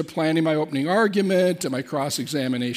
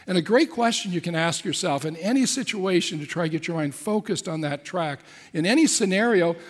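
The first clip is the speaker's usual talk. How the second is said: ordinary talk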